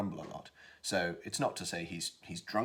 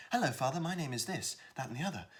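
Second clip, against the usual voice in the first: higher-pitched youthful greeting; lifted, lively young character voice; young son; 8 semitones higher than usual. Shown as a demonstration. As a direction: chirp it like an eager young son. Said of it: "if you have a character that starts saying".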